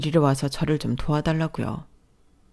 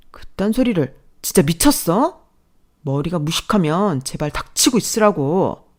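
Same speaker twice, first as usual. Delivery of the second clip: sister-in-law sneering back; mildly acted contemptuous sister-in-law; nasty sister-in-law; 4 semitones higher, 5 dB louder than usual. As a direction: belittle the brother and wife.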